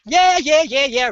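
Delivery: high, bouncy yeah yeah; energetic yeahs. Retold or imitated. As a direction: burst in brimming with energy. Her energetic arrival, imitated.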